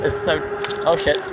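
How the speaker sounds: ordinary delivery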